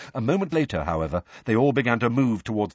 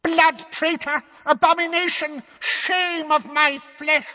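very high, slow shrieking curses; drawn-out screech of outrage; howling old woman. A narrator's reading. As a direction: howl each insult at the traitor. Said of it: "she howled".

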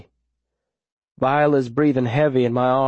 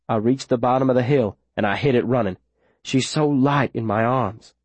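usual speaking voice

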